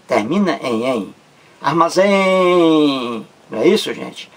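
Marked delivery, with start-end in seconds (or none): 1.6-3.2 "Armazém" with a very long, high "zém"; stretched last syllable. Shown as a demonstration. Teacher giving an example of the rule, drawn-out "zém" in "Armazém".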